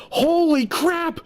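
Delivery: high, slow astonished exclaim; amazed shout. Retold or imitated. Fat guy in a big store, stunned and delighted. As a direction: gasp it out like you can't believe the size. Amazed at huge clothing sizes.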